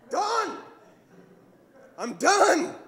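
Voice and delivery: high, abrupt cry of being done; rushed man shouting that he's finished; triumphant man. Acted. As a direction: pop it out, pleased to be finished. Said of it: "impression of a typical man".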